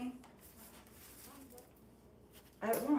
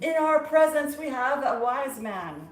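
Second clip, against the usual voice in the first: mock proclamation; 5 semitones higher, 12 dB louder than usual. Mock announcing a wise man.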